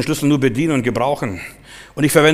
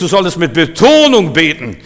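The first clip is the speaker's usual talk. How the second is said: "Betonung" punched louder and higher; stress on Betonung; 4 semitones higher, 7 dB louder than usual. Shown as a demonstration. Preacher urging listeners to pray with feeling, emphatic stress on "Betonung". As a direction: lean hard on "Betonung".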